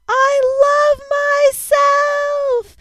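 shrieked very high and loud with joy; soaring giddy cry of self-love; ecstatic shout. Retold or imitated. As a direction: scream it to the whole city from the back of a Vespa. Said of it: "being like".

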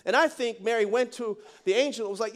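usual speaking voice

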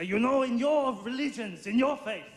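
put-on emphatic German-accented preacher. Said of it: "this is my German accent".

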